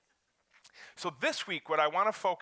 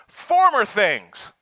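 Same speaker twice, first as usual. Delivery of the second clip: "Former things" belted much louder and slow; with enthusiasm; 7 semitones higher, 13 dB louder, much slower than usual. Shown as a demonstration. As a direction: throw real energy into "Former things". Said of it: "say that with enthusiasm".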